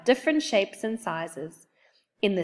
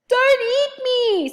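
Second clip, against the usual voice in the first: high, slow frightened plea; boy begging the monster not to eat him; scared squeal; 7 dB louder, slower than usual. A narrator's reading. Oliver startled by growling.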